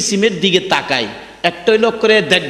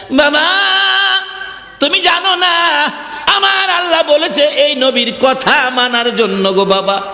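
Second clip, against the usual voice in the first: high, chanted protest to father; daughter's outcry; 9 semitones higher than usual. Acted. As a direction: wail it at father, pitch jumping up then easing down. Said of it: "মেয়েটা একটা চিৎকার মারছে".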